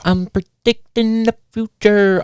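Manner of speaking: high, slow, drawn-out put-on voice; mock prophecy. Host joking he foresees things like The Simpsons, mock prophecy. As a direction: stretch it out high like a joke fortune-teller.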